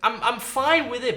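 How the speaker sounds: ordinary talk, nothing marked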